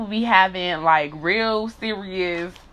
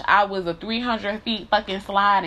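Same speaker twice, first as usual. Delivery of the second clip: usual speaking voice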